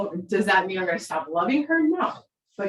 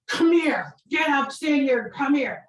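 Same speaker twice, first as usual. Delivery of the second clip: stern calling out; 8 semitones higher, slower than usual. Retold or imitated. Husband marching upstairs, calling the daughter out firmly. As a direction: bark the orders like an angry dad. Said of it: "he's like".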